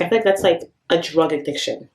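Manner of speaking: normal talking voice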